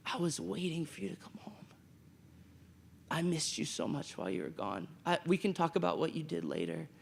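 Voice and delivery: soft whispered welcome; gentle father. Retold or imitated. Forgiving father to returning son, soft and tender whisper. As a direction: hush it tenderly, like a relieved dad. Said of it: "gentleness in his tone of voice".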